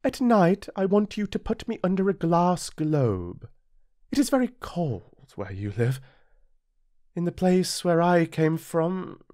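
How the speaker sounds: high, dainty flower; sweetly polite, a touch vain; lightly voiced proud flower. A narrator's reading. Flower voice held.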